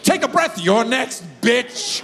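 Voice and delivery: crazy mom threatening; high mock menace. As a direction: snap her words back. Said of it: "My mom goes".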